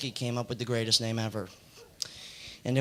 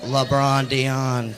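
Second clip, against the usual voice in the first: louder, very drawn-out name reveal; slow, relished unveiling of the name; savoured punchline; 6 dB louder, much slower than usual. Retold or imitated. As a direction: stretch each syllable out with weight.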